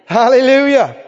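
loud, ringing hallelujah; shouted praise. Real, not acted. Preacher rejoicing with the congregation, loud. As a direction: cry out praise to God.

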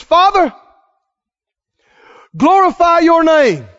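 loud, high cry to heaven; calling out to God. Acted. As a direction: call up to the Father with full force. Jesus calls up to the Father.

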